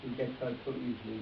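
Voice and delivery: usual speaking voice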